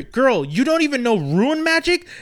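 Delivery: incredulous scolding, pitched high and drawn out; Agatha. Retold or imitated. Witch testing Wanda in the basement, incredulous mock scolding. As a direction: imitate a scornful teacher, high and incredulous. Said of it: "she's telling her".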